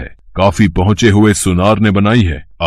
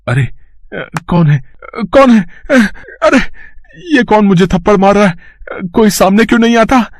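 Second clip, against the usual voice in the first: much higher, fast, frightened yelps; panicked cries; 12 semitones higher than usual. Acted. Thief slapped by invisible Hanuman, panicked high cries. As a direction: squeal in terror at unseen blows.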